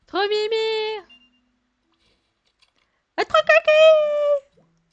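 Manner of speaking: put-on very high, cooing baby voice; high sing-song baby talk, drawn out; cutesy baby talk. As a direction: gush over a cute creature. Cooing at a cute Pokémon.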